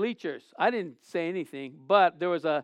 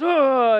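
loud high ugh of disgust; retold disgusted grunt; 6 semitones higher, 8 dB louder than usual. Brother in the bleachers scoffs at goalie, loud high grunt.

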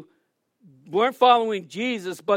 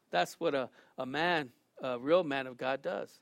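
usual speaking voice

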